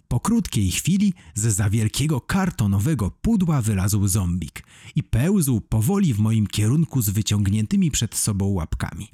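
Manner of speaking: ordinary delivery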